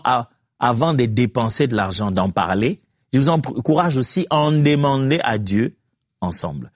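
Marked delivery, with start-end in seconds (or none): none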